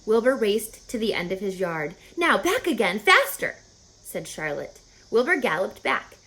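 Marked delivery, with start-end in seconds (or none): 2.2-3.6 urging on. Acted. Charlotte coaching the running pig.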